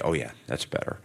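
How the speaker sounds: a hint of a low soft inner voice; lightly voiced inner gut, low and soft. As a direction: murmur it as your gut talking. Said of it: "your gut will say".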